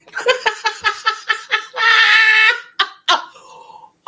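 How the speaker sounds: high loud cackling laughter; helpless high laugh; shrieking laugh. Real, not acted. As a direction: burst out laughing. Reactor laughs at story.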